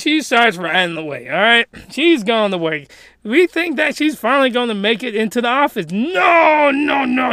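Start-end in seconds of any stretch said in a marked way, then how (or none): 5.9-7.3 emphatic denial. Acted. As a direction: cry out the first no, then tail off. Acted dismayed denial of expectation.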